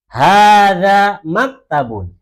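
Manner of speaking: slow, loud, drawn-out Arabic, every syllable of "Hada masjidun" stretched; slow model reading. Shown as a demonstration. Teacher modelling Arabic for the class, slow loud recitation. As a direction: chant it slowly for the class to repeat. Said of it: "yang lain ikutin".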